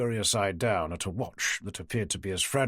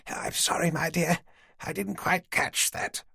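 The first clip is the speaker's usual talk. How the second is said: put-on higher, frail old woman's voice; an old lady patiently humouring the police; elderly lady; 5 semitones higher than usual. Old woman Dorothy gently agreeing to talk, higher old voice.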